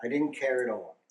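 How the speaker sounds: normal talking voice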